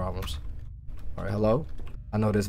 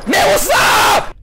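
slow, high startled yell; startled shout; 10 dB louder, slower than usual. Real, not acted. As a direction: shout in fright.

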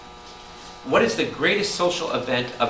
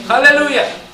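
loud, high cry of exultation; praise shout; 13 semitones higher, 11 dB louder than usual. Real, not acted. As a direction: shout it out to rouse the congregation. Preacher cries out praise mid-sermon.

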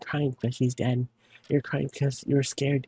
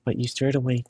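ordinary talk

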